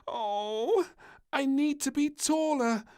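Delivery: high, loud whiny moan; moaning princess. A narrator's reading. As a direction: complain you're too short. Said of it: "moaned the little princess".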